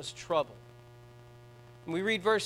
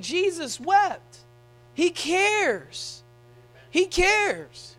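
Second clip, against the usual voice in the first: slow emphatic; 9 semitones higher, much slower than usual. Real, not acted. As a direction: draw out each word slowly and higher. Emotional climax of the sermon.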